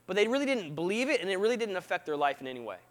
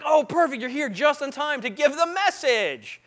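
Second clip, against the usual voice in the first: mock delighted welcome; 5 semitones higher than usual. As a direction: pounce on the visitor with eager relief. Hosts greet the late visitor, acted.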